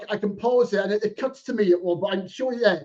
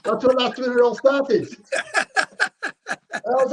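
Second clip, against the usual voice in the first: laughing; 3 semitones higher, much slower than usual. Real, not acted. Joking about the film turning him grey.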